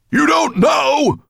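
high, very loud roaring tirade; outraged dragon's roar; furious bellow. A narrator's reading. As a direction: bellow in rage at a useless servant. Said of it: "Nettlebrand bellowed so loud".

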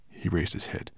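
ordinary delivery, nothing marked

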